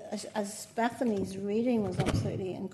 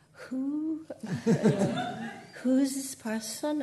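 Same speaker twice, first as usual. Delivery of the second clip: baffled who; 5 semitones higher, 3 dB louder, slower than usual. Retold or imitated. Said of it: "and I'd go".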